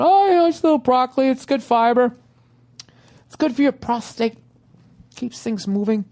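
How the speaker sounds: high, chirpy mock ballplayer boasting; cheery ballplayer voice. Player on a seventies team defending his broccoli dip, high and chirpy.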